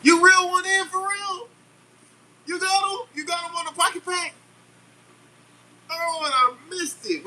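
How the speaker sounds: thrilled high-pitched disbelief; excited high-voiced gush; excited squeal. Real, not acted. Excited reaction to viewer's catch.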